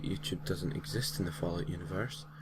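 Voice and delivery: plain reading voice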